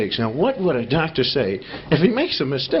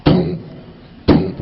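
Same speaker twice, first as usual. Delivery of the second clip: usual speaking voice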